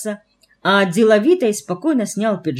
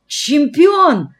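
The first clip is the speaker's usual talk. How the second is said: high, loud, awestruck cry; rapturous exclamation; 9 semitones higher, 5 dB louder than usual. A narrator's reading. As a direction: exclaim it with soaring adoration. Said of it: "восторженно выдохнул Фома". (восторженно, высоко и громко)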